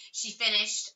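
ordinary delivery

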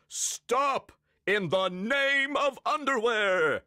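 high, booming heroic command; Captain Underpants. A narrator's reading. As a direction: proclaim it to halt the villains. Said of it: "he cried".

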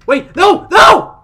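high, very loud screams of dismay; frantic high yelling; outraged shouting. Real, not acted. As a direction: scream in real disbelief. Disaster strikes in game.

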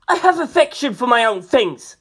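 high, loud indignant snap; vigorous snap. A narrator's reading. Artist snapping at Mister, high loud indignant snap. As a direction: snap back hard enough to make him back off. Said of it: "Kashibi snapped with such vigour".

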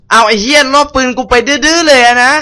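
high, loud indignant shout; outraged yell. Real, not acted. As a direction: yell at the thief in disbelief. Enemy steals his gun. (ตะโกนโมโห)

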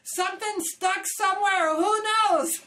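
helpless and flustered. Retold or imitated. Young crew member flustered and unsure when answering Ron. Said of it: "What did he say to say".

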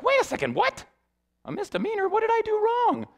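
high-pitched, incredulous squeal; acted disbelief. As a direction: play up the shocked protest, way up high. Retold shock at misdemeanor charge.

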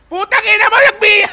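high, loud outburst; shouted trash talk. Real, not acted. Player cursing at his rival mid-game, shouting. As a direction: yell the curse at full volume.

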